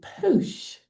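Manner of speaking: drawn-out, plummy posh British; slow, exaggerated posh vowel; posh accent. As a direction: say it in a mock posh English accent. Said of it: "they all sounded more or less the same".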